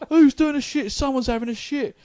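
loud, high, jeering shout; mock crowd yelling. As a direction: rile up the whole queue with a loud, high jeer. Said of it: "everyone starts going".

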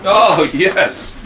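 normal talking voice

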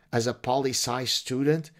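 ordinary delivery

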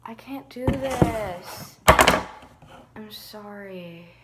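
slow, drawn-out despair; defeated. Real, not acted. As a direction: drag it out, defeated. Defeated after trying again.

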